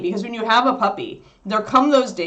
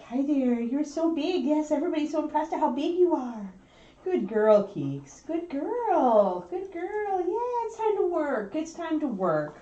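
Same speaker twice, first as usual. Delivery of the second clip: puppy talk; 7 semitones higher, 6 dB quieter than usual. Real, not acted. Owner cooing softly to her big puppy.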